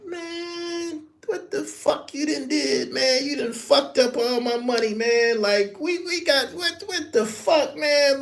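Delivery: high, incredulous complaining, half laughing. Retold or imitated. [name] complaining to [name] about his money, high mock exasperation. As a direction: whine that he's lost all his money.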